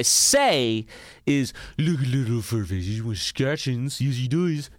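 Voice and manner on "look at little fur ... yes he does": sing-song pet voice; baby talk to a dog. Pretend. Podcaster faking delight at a listener's dog photo, baby talk. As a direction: coo at the pup like a baby. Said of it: "That's what I say".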